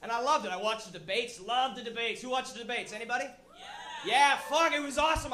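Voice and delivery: usual speaking voice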